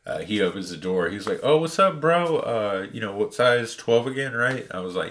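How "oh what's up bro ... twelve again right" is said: high, loud, breezy mock greeting; cheerful greeting. Store clerk greeting a regular customer, high and breezy. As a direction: chirp it like a friendly store clerk.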